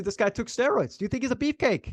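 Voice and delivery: normal talking voice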